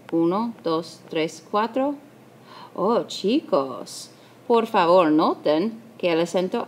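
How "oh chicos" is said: ordinary delivery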